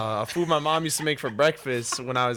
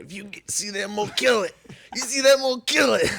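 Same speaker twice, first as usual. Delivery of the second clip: high-pitched retold mom's order; mom's command; 8 semitones higher than usual. Mom telling her kid about the garden mole, insistent retold order. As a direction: imitate an insistent mom, voice raised. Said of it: "she always said to me, she said".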